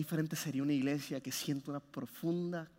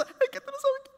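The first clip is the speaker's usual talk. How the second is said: much higher voice as a weepy woman; squeaky, pitched-up mock woman brushing it off; woman's reply; 4 dB louder than usual. Woman answering why she cries.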